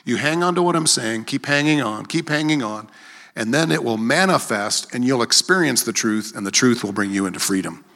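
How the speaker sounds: ordinary talk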